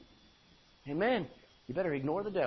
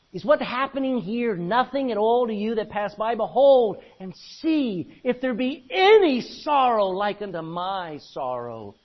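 loud, declaiming King James reading; scripture reading; 5 semitones higher, 10 dB louder, slower than usual. Acted. Preacher reads Lamentations to congregation, declaiming. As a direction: proclaim the verse to the hall.

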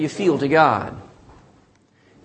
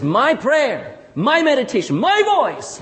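each phrase climbing higher; fervent emphasis; 6 semitones higher, slower than usual. Retold or imitated. Preacher quoting David's prayer, fervently emphatic. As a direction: build insistently on each "my", pitch rising.

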